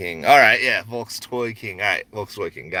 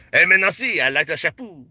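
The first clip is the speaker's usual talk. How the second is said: put-on high, playful French-accented compliment; mock French accent; 6 semitones higher, 3 dB louder than usual. Shop owner pretending to be French, mock French accent. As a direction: ham up a higher French voice. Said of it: "play this role of some French man".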